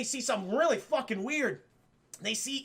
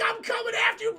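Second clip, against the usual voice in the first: mock heroic shout; 12 semitones higher, 3 dB louder, faster than usual. Luigi vows to chase Daisy.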